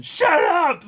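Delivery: very high, loud comic yell; mock shout. Acting out the anime's outburst, very high shout. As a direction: scream it out in comic frustration. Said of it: "And then".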